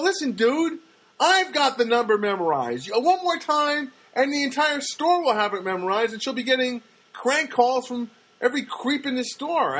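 high, loud, worked-up mock outburst; exasperated rebuke. Annoyed customer lecturing the cashier, exasperated.

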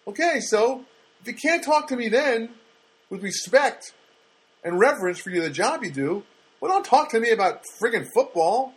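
heated, high-pitched telling-off; passionate rant. Real, not acted. Customer tells store worker not to talk football, passionate rant. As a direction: build back up to an exasperated high finish. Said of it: "passionate about that, aren't I?".

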